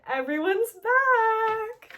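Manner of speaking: high, loud, drawn-out BACK; excited announcement. Real, not acted. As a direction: sing it out with a long final word. Celebrates the favorites' return.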